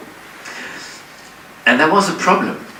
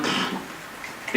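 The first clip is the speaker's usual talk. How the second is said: soft puff of air through the lips; light, voiceless puff; dismissive puff; 14 dB quieter than usual. Retold or imitated. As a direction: blow it off with a quick, quiet pff. Dismissing study after one look.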